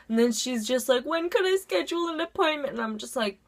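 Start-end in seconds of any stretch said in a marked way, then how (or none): 1.1-2.7 high, whimpering phone voice; imitated crying. Crying teacher phoning a therapist, mock tearful voice. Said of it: "like, crying and on the phone".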